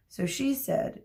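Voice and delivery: usual speaking voice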